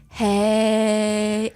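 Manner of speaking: one long level "Hey"; mocking, monotone drawl on "Hey"; dragged out. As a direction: stretch "Hey" out like a slow talker. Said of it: "and you drag it on".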